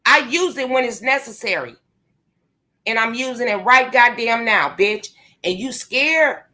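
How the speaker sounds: ordinary talk, nothing marked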